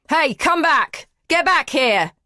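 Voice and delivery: high, loud calling out; shouting after her. A narrator's reading. Man calling after the fleeing woman, loud shout. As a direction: yell down the hallway. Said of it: "he shouts".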